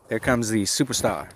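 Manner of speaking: ordinary talk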